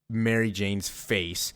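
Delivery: normal talking voice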